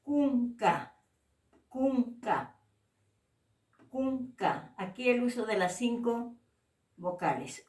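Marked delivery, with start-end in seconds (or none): 0.0-4.7 CUNCA with unaspirated plain k sounds; crisp k without breath in CUNCA; clean K. Shown as a demonstration. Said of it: "K limpia".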